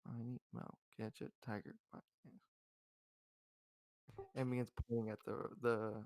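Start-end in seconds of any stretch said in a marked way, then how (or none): 0.1-2.4 softly murmured counting rhyme. Acted. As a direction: chant it quietly, almost to yourself. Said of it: "very softly just goes".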